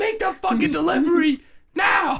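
high, hammed-up shouting; mock shouted order. Amazon bosses to a driver who won't go, mock shouting. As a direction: play an outraged boss shouting orders.

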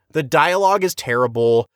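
ordinary talk

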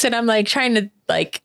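ordinary delivery

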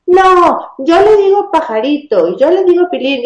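agudo y un poco más fuerte, protestando; imitando a padres que protestan. Retold or imitated. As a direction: como padre que defiende el apodo. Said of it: "me dicen". (padres del taller protestando, voz aguda y más fuerte)

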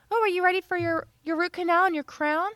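cheery receptionist. Retold or imitated. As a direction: play the chirpy front desk lady asking. Receptionist voiced in dentist story.

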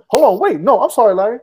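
loud, hurried take-back; shouting an apology over the crosstalk; loud interruption. Real, not acted. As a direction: call out sorry over the others.

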